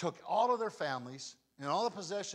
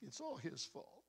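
bitter murmur; 12 dB quieter than usual. Retold or imitated. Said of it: "one of them says".

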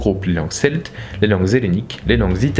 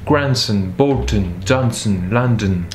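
grandson, Bolton, Johnson, London, measured, with strong first syllables; stress on first syllable; 2 semitones lower, slower than usual. Shown as a demonstration. Teacher drilling two-syllable words, first syllables stressed. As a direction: hit GRAND, BOL, JOHN, LON and drop the endings. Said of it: "First syllable".

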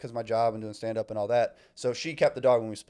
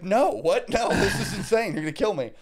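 mock alarmed; 7 semitones higher, 3 dB louder than usual. Retelling her panicked refusal.

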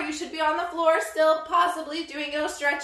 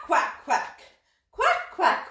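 quacking. Shown as a demonstration. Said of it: "we go quack".

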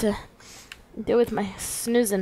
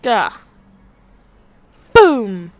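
usual speaking voice

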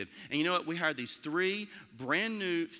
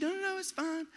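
much higher, quick nervous denial; backpedaling teacher; 13 semitones higher than usual. Retold or imitated. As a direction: wave it off, suddenly flustered. Teacher backpedals after being challenged.

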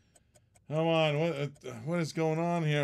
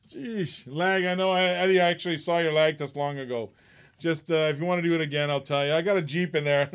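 normal talking voice

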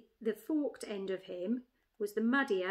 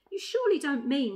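lightly voiced jackdaw, higher and louder; incredulous jackdaw; 4 semitones higher, 8 dB louder than usual. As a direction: challenge the badger in disbelief. Jackdaw questions the badger.

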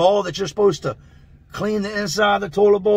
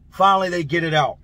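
ordinary delivery

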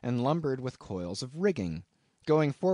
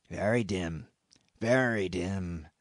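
drawn-out murmured very dim; slow brooding echo; 5 semitones lower, slower than usual. A narrator's reading. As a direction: let it sink in, slowly. Elijah repeats slowly.

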